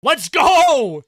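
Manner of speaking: high yell with a long "go"; celebratory cheer. Real, not acted. Commentator celebrates the Oilers' goal, excited yell. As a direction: cheer it out in triumph.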